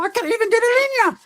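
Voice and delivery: very high, loud protest; retold high-pitched holler; exasperated outburst. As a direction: burst out in disbelief at a friend. Acts out excited protest to friend.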